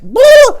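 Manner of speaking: very high, loud boom; impact yell. Acted. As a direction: blast out the hit. Impact noise in fight.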